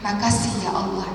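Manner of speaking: hushed grateful murmur, voice held steady; inner thanks. Retold or imitated. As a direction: say it as if only in your heart. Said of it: "Namun dalam hati".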